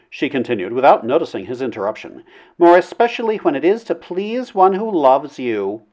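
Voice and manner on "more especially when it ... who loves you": high-pitched lady's voice; wooing princess, coaxing. A narrator's reading. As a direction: woo him in a high, sweet voice. Princess woos him.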